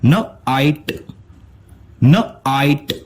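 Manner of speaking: न आइट split into न then आइट; sounds split apart. Shown as a demonstration. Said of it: "इसका sound बनेगा".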